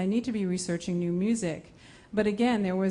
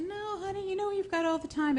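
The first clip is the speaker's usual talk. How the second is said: soothing dad; 12 semitones higher, 4 dB quieter than usual. Retold or imitated.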